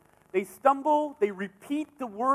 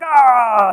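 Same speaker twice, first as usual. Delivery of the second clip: loud demo yell "Ah"; short shouted scream; primal scream; 2 semitones higher, 11 dB louder than usual. Shown as a demonstration. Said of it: "let out a primal scream".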